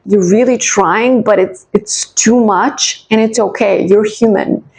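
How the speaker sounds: normal talking voice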